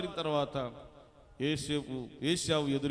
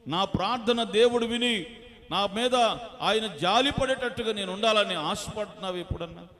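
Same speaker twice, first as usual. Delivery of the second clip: loud, high, driving sermon; fervent preaching; 6 semitones higher, 10 dB louder than usual. Acted. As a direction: preach it out with full force. Preaching at full force. (బలంగా ఉద్రేకంగా ప్రసంగం)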